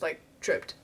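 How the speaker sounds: usual speaking voice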